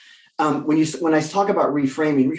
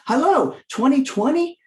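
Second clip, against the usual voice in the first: exasperated hello; 11 semitones higher than usual. Acted. Exasperated greeting to the year.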